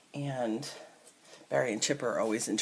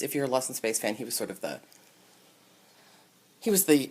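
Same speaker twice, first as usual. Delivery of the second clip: ordinary delivery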